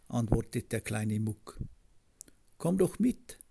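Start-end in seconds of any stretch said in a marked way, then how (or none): 2.6-3.4 twittering bird. A narrator's reading. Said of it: "zwitschert Juck".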